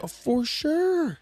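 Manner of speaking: high, slow, stretched-out for sure; mock drawl. Friend teasing about the fox owner, drawn out high. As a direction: drag out the agreement in a put-on voice.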